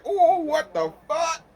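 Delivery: high, slow, laughing disbelief; laughing quote. Retold or imitated. His own shocked reaction, retold laughing. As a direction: blurt it out cracking up. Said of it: "I was like".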